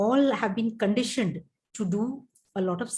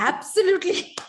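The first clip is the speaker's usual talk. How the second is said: high-pitched, through real laughter; helpless laugh breaking into the word; laughing agreement; 11 semitones higher, 4 dB louder than usual. Real, not acted. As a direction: burst out laughing while agreeing. Cracks up at frog joke.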